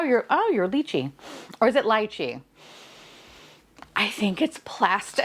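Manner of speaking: ordinary talk, nothing marked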